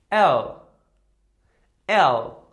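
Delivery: L called out loud, el; letter name demo. Shown as a demonstration. Teacher calling out the letter for repetition, loud. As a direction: call out L loud and clear. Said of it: "Exactly like English".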